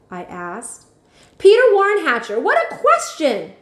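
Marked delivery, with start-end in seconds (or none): 1.4-3.5 high, loud full-name scold; outraged grandmother using his full name; scolding shout. Acted. Grandma scolding Peter, loud and high. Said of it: "Grandma shouted".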